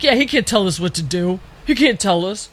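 mock-defiant put-on voice of the crowd. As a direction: sneer it like a stubborn New Yorker. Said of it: "And they were like".

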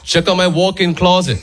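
bragging dad. Retold or imitated. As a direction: imitate a dad showing off his house. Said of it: "loves bragging about his house".

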